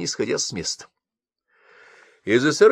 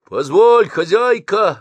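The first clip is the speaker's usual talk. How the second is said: much higher, much louder yell; 11 semitones higher, 9 dB louder, slower than usual. Acted. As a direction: shout her down. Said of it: "орал старшина".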